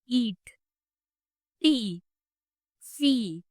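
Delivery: ईट, टी, सी with a long held ee; teacher drawing out the long ee sound; long ee demo. Shown as a demonstration. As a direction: stretch the ee in ईट, टी, सी. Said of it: "एक long sound आ रहा है".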